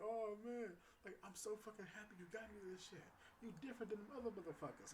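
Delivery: put-on playful take on her New York accent; New York imitation. Date thanking him for flowers, in a put-on New York accent. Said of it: "imitate a New York voice".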